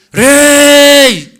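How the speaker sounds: grito agudo y muy fuerte; llama al rey a todo pulmón. Acted. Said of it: "Luego gritó era fuerte". (la madre grita fuerte al rey)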